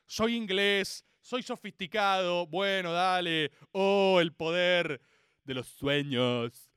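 slow, low, mock-sophisticated drone; Neil Gaiman. Sophisticated English author on dreams, slow low parody.

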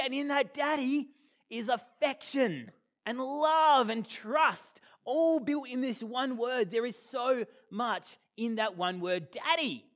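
slow, high, swelling emphasis; fervent preaching. Acted. Preacher dwelling on the word daddy, slow and fervent. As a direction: preach it, savouring each word.

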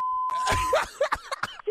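high unvoiced shriek of laughter. Real, not acted. Woman finds out it was a prank, shrieking laugh. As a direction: burst out shrieking.

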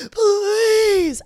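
high, loud crying gasp then begging please; sobbing plea. Retold or imitated. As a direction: reenact begging through tears, voice cracking high. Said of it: "I remember crying, being like".

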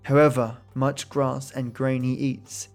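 plain reading voice

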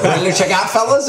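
proud, showing off, voice up high; mock-proud high show-off voice; smug pride. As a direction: boast like he's won something. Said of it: "all proud of himself".

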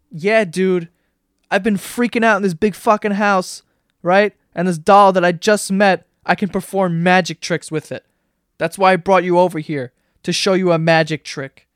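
exasperated, emphatic sarcasm; mock sarcastic retort. Woman answering the clueless guy about the doll, sarcastic. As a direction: lay the sarcasm on thick, punching the words.

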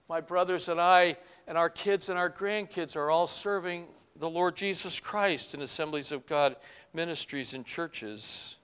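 ordinary delivery

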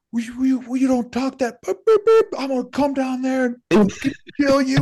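high-pitched imitation of a cursing rant; hammed-up sweary threat; mock tirade. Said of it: "a string of F-bombs on a tirade".

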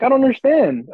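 genuinely lost at what happened; retold bewilderment. Fan quoting his reaction to the loss, high bewildered plea.

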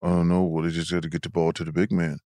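very low-pitched mumbling big-man impersonation; deep, low imitation of a huge ballplayer; Shaq. Shown as a demonstration. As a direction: drop way down deep, softly grumbling. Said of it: "can you imitate him".

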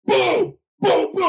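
high punchy booms; mock crash sounds. Pitcher showing teammates how the chair banged, punchy booms. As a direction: imitate the chair smashing. Imitating the chair hitting the tunnel.

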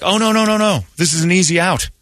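alarmed, insistent "no, no, no" at the screen; high-pitched exasperated urging; urgent warning. Retold or imitated. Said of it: "I'm like".

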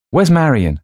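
high, loud anxious question; calling out. A narrator's reading. Louise asks the silent room, calling out. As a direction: call out to the whole room in worry. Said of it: "called Louise".

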